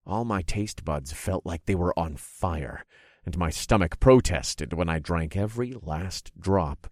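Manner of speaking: usual speaking voice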